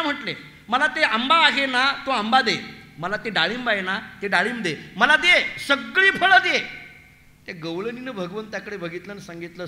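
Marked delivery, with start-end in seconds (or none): 0.7-6.9 loud demands building to a high, drawn-out finish; insistent child's demand. Acted. Child Krishna to the fruit seller, demanding ever louder. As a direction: keep pressing, then demand everything.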